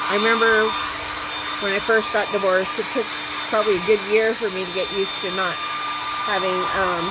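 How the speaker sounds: talking loudly over dryer noise; over hair dryer. Real, not acted. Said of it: "so please watch your ears".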